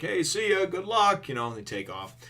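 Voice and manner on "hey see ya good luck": high, breezy send-off; breezy mock farewell. Yusaku and Johnny taking off, breezy mock farewell. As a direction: imitate a quick, carefree goodbye.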